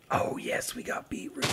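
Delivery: voiceless, gleeful whisper; whispered delight. Real, not acted. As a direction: whisper it like a small private triumph. Pleased at finding beetroot.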